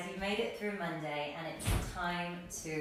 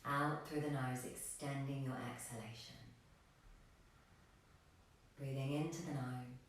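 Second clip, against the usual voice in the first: soft, unhurried meditation voice with long pauses; guided meditation; 3 semitones lower, 8 dB quieter than usual. Real, not acted. Yoga teacher guiding extended exhalations, soft meditation voice. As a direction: lead them quietly toward stillness.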